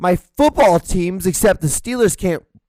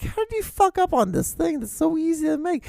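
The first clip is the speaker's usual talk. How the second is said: baffled disbelief; 3 dB quieter than usual. Real, not acted. Comedian baffled at people ruining easy pizza, high-pitched disbelief. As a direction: jump way up high in bewilderment.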